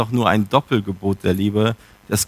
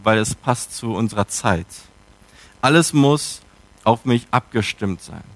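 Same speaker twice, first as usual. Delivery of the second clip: normal talking voice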